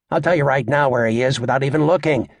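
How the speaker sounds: ordinary delivery, nothing marked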